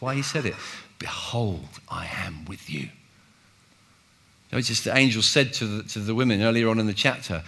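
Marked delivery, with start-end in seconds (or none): 1.0-2.9 whispered, then low and soft; gentle whispered reassurance; whispered promise. Shown as a demonstration. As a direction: whisper it tenderly.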